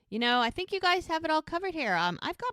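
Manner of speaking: loud, high-voiced polite backing out; high-pitched excuse. Pregnant [name] bowing out beside the horse, high-pitched excuse.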